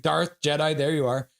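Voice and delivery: ordinary talk, nothing marked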